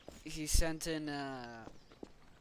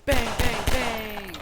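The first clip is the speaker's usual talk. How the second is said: loud, high repeated bangs; explosive mock gun noises; imitated gunfire; 7 semitones higher, 13 dB louder, much slower than usual. This guy firing at the others, imitated gunfire.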